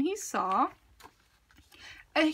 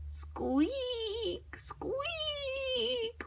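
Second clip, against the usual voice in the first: higher, softer and slow whispered squeaking; hushed creepy squeak; 12 semitones higher, 7 dB quieter than usual. A narrator's reading. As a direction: breathe it out spookily.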